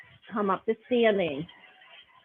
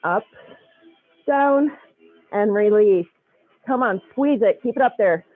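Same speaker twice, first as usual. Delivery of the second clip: aerobics instructor; 5 dB louder than usual. Acted. Loud cueing through glute bridges.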